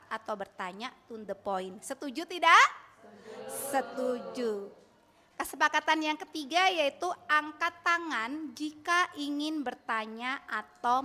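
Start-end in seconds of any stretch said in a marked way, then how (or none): 1.8-9.1 calling out. Real, not acted. Teacher calls out to the class.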